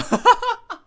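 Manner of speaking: high loud burst of laughter; gleeful laugh. Real, not acted. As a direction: laugh with delight. Gleeful at revenge. (得意地大笑)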